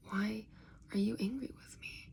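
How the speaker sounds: gentle whisper. Retold or imitated. Won pleads gently.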